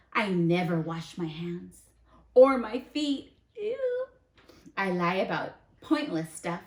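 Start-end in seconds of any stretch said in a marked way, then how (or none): 2.3-4.1 higher, louder mock disgust. Teacher reacting to the seed's dirty feet, mock disgust.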